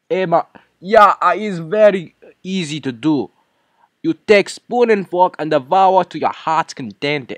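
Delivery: hammed-up chef urging guests to eat; chef [name]. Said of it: "introduce our chef of the hour, [name]".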